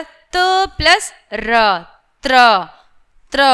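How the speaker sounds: त plus र joined into त्र; slow, then fuse त्र; त्र built from त and र; sounding out a conjunct. Shown as a demonstration. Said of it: "त्र कैसे होता है".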